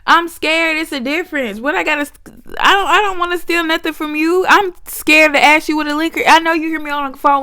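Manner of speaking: high, indignant protest; high mock indignation at the staring clerk; high sassy rant. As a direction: talk back at the clerk in her head.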